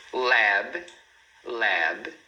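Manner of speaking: LAB said alone, ending on the B; clean final consonant. Shown as a demonstration. Teacher gives examples for students, correct pronunciation. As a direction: say "lab" as one syllable, no vowel after B. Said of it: "palavras que terminam com consoante".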